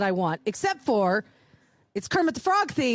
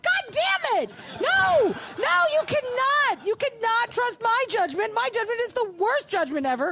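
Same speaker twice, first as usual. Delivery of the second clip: exasperated outburst; 9 semitones higher than usual. Retold or imitated. To Iris who trusts her judgment, a high exasperated outburst. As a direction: wail in mock despair.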